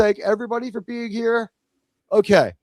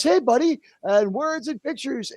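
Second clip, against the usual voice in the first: ordinary talk, nothing marked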